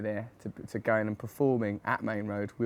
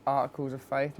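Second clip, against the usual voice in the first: usual speaking voice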